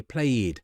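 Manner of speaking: "played" wrongly split in two, as play-id; extra-syllable mistake. Shown as a demonstration. Teacher imitating what many people say, "played" as play-id. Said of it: "don't pronounce these words with a".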